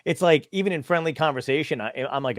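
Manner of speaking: normal talking voice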